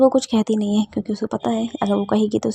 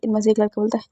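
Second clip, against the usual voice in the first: plain reading voice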